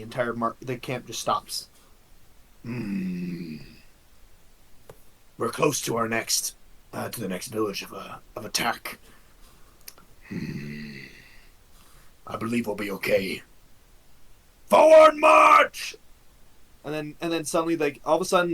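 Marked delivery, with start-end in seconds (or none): 2.6-13.4 low, softer orc commander voice; deep and quiet, menacing orc leader. Acted. Orc commander speaks to troops. 14.7-15.9 high, loud, drawn-out battle cry; commanding shout. Acted. Commander orders army onward.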